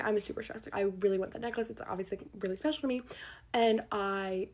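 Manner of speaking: ordinary delivery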